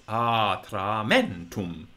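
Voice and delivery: Atramentum very slow, two long a's, stressed short MEN; long unaccented vowels. Shown as a demonstration. Teacher repeating a word English has no match for, long unaccented vowels. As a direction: stretch "aah-traah", then accent MEN in Atramentum. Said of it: "are long and unaccent".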